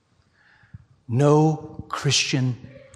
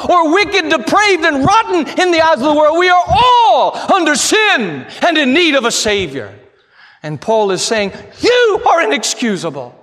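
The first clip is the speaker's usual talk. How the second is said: loud, pounding pulpit shout; full-force preaching shout; 9 dB louder than usual. Acted. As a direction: drive every word at the pews. Shouted climax of sermon.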